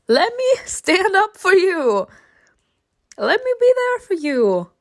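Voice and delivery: high, emotional pleading; exasperated plea. Real, not acted. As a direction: beg him in a high voice. Pleading with a character to accept help.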